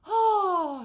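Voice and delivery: sudden loud high "Oh" of release; loud release sigh. Shown as a demonstration. Yoga teacher demonstrating a stretching sound, loud high release. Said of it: "I'll make, like, a".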